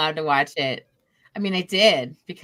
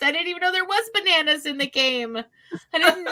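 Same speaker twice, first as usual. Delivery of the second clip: ordinary delivery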